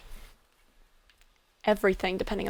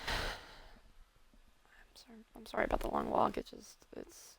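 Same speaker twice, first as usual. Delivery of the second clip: much softer, barely voiced; anxious whisper; 12 dB quieter, slower than usual. Apologizing to friend after panicking, in a whisper. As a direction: whisper the worry, nearly gone. Distraught character after emotional outburst.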